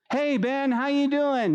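high, bright-voiced hello to a friend; friendly greeting. Pastor voicing a friend greeting Ben at the wall, high and friendly. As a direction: call out a cheery hey to a buddy.

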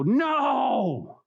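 long high-pitched wail of refusal; indignant protest. Acted. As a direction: stretch it out in mock outrage. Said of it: "and they're indignant".

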